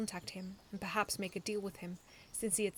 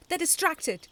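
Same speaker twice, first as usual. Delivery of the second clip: loud, higher rallying orders; urgent battle cry; 8 semitones higher, 11 dB louder than usual. A narrator's reading. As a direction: push your team all out. Urging the team in battle.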